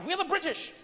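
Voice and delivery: high, self-important British claim; haughty British character. Acted. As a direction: pull rank as a pompous Briton. British character pulling rank.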